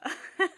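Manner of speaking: sudden high, loud laugh; short, loud burst of laughter. Real, not acted. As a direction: burst out laughing mid-thought. Laughs at own remark.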